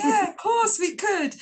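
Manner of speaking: eager, high and loud yes; excited acceptance. Retold or imitated. Daughter agreeing to live with her mum, excited. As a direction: agree with a burst of drawn-out enthusiasm. Delighted acceptance of mum's suggestion.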